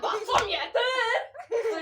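high laughing yell; laughing shout. Real, not acted. Yelling at Bessam laughing. (gülerek bağırma)

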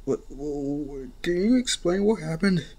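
ordinary delivery, nothing marked

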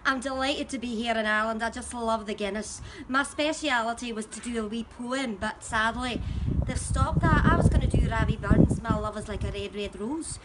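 broad Glaswegian lilt, cheeky and chatty; thick Scottish Glasgow accent, wry and dry. Acted. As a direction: Glasgow contestant chatting up the crowd. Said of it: "I'm the Scottish Rose, all the way from Glasgow".

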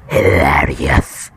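very low, slow, rasping voice. Real, not acted. Gamer laughing at a teammate's fall, raspy low croak. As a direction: croak it out from deep in the throat. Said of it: "I spoke in such a rasp voice".

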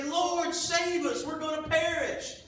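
high, loud panicked cry for help; panicked plea. Acted. Frightened disciples cry out to Jesus in a high panicked plea. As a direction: beg to be saved from the storm. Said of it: "the disciples are scared".

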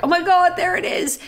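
high-pitched imitated delight; excited retelling. Makeup artist hearing the accent, imitated high excitement. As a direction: pitch it up like spotting something famous. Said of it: "she was like".

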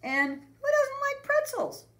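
put-on very high, slow, loud kid voice; thinking aloud. A narrator's reading. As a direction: squeak it out, convincing yourself. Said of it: "he thought".

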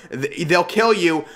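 normal talking voice

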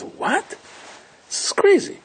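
stunned at the bill; outraged surprise. Retold or imitated. Pirate shocked by the doubled bill, very high.

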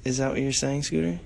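usual speaking voice

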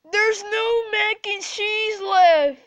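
high, slow wailing sob; fake crying. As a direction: sob the line out. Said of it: "and fake cry".

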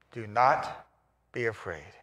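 reassuring. Acted. Comforting the scared listener.

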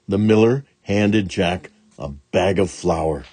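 ordinary delivery, nothing marked

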